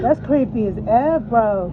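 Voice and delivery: excited loud. Real, not acted. Reacting to creepy building.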